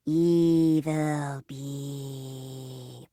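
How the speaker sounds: very slow, low menacing beep; evil beep. Acted. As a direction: drag it out like a villain. Evil robot.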